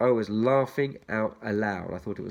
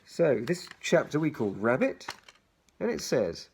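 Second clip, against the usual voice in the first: ordinary delivery, nothing marked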